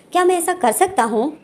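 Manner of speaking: lightly put-on high, innocent voice; coy high voice. Wing teasing his mother about a new boyfriend, coy and innocent. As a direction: ask it sweetly, playing innocent.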